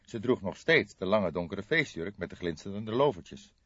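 usual speaking voice

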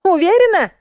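very high querying voice; doubtful question. A narrator's reading. Musenka checks with drenched narrator, doubtful. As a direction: ask in high-pitched doubt.

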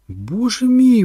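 high, loud cry of alarm; alarmed exclamation. A narrator's reading. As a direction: cry out in shock. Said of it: "вигукнула Кетрін". (стривожений вигук)

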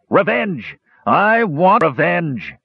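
shouted. A narrator's reading. Gorath racing into the forest, shouting for revenge. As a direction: roar it out, dragging the words. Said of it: "he shouted".

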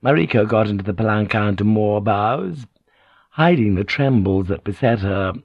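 ordinary delivery

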